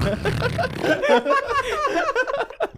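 shrill burst of laughter; high squealing laugh. Real, not acted. Glitchy shared laugh moment.

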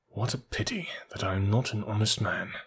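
hushed, half-whispered mutter. A narrator's reading. Captured thief to himself before Ganimard, muttered very softly. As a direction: murmur it softly under your breath. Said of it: "I muttered".

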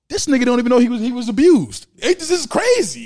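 can't believe what he's hearing; loud shocked disbelief; incredulous. Real, not acted. Shocked retelling, raised voice.

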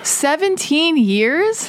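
slow, high echo of disbelief; astonished. Real, not acted. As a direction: stretch it out in shock. Host reveals long marriage.

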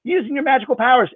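put-on high outraged little-girl voice; a kid who caught him red-handed; indignant child. Sarah catches him cheating.

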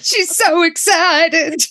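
high, drawn-out mock excitement; mock overexcited. Host spoofing the caffeine pills scene, mock overexcited. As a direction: stretch it out like a hammy TV meltdown.

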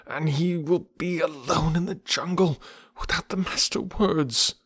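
put-on high, lamenting old bear; a bear wailing in self-pity; Baloo the bear. A narrator's reading. Baloo grieving to Bagheera over lost Mowgli.

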